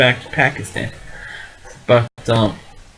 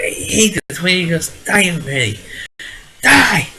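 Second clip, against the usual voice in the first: retold scream; 5 semitones higher than usual. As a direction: imitate her shrieking in the theater, peaking on the last word. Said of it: "she's screaming in the movie theater".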